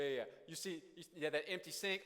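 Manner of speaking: usual speaking voice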